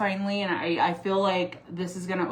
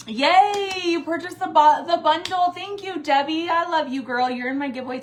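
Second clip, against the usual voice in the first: much higher and louder, gushing with delight; excited thanks; 9 semitones higher, 7 dB louder than usual. Real, not acted. Thanking Debbie on the livestream for buying the bundle, excited. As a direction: cheer for the buyer like a friend.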